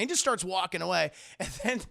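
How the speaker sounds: ordinary talk, nothing marked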